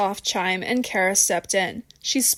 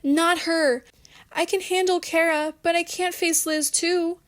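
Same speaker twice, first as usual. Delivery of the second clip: higher-pitched, small pleading voice; barely audible plea; 8 semitones higher than usual. A narrator's reading. Rebecca begs not to face Liz, small and pleading. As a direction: plead weakly in a high voice. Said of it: "her voice barely audible".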